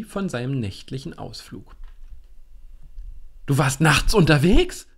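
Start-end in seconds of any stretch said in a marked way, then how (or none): 3.5-4.9 loud, alarmed call; startled loud call. A narrator's reading. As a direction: shout it across the table in disbelief. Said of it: "rief Willi".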